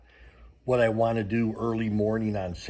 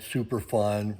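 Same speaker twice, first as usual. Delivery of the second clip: normal talking voice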